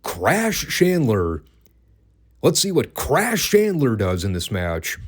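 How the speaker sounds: CRASH CHANDLER stretched out and pitched high; openly mock the name "Crash Chandler" with swooping emphasis. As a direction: lean hard and slowly on "Crash Chandler". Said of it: "you hear J R emphasizing the name".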